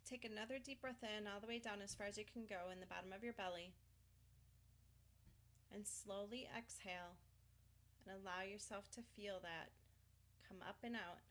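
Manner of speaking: soft, unhurried meditation voice; guided breathing. Acted. Tapping coach guiding a client to release energy, soothing.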